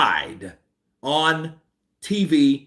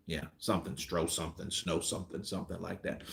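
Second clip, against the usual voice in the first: much softer trailing mumble; mumbled aside; 7 semitones lower, 16 dB quieter than usual. Real, not acted. Vlogger fumbling a wrestler's name, muttering softly. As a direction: mutter it to yourself.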